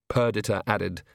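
usual speaking voice

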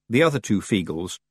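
usual speaking voice

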